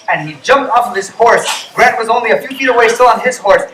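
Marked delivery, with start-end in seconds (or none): none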